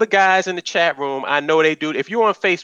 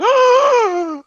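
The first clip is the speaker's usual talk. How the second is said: high, loud hammed-up gasp; mock shocked gasp; 5 dB louder than usual. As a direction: gasp in exaggerated outrage. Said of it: "And then she goes".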